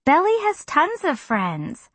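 light, slow, breezy assurance. A narrator's reading. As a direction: toss it off breezily. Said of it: "in her breezy way".

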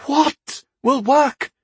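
high, drawn-out exasperated demand. A narrator's reading. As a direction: stretch each word in rising frustration.

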